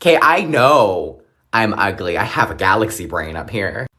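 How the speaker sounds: ordinary talk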